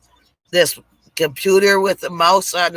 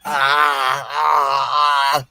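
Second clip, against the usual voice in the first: garbled sick man; 5 semitones lower, much slower than usual. Retold or imitated. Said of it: "he was talking all kinds of funny".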